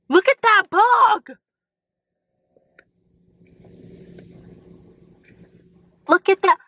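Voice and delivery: startled by bug. Real, not acted. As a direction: squeal at the bug you just spotted.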